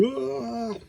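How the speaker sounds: high, drawn-out "aah" without much volume; mock scream. Reviewer imitating a bandit hit by a sword, mock scream. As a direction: imitate a wounded bandit's cry, held back.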